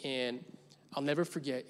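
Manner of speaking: normal talking voice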